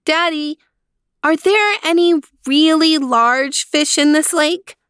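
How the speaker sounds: put-on high, bright-eyed child voice asking; little girl Leanie. Child Leanie asks father.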